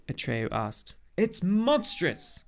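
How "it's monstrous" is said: high, slow cry of outrage; indignant cry. A narrator's reading. Old centaur outraged at the empress's choice, crying indignantly. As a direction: draw it out, scandalised. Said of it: "cried Chiron indignantly".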